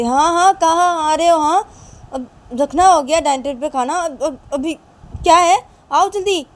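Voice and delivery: high, loud impatient mother yelling; shouting. Acted. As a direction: yell for him to come quickly. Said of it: "Uma की mummy चिल्ला के बोलती". (चिल्लाती हुई माँ)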